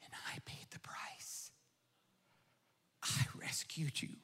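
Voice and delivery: hushed tender whisper. Acted. The Lord whispering to John about rescue. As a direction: whisper it like a secret of love.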